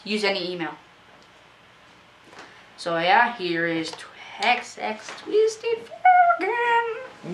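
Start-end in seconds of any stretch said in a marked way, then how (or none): 5.2-7.3 announcer voice. Pretend. Intro name in odd voice.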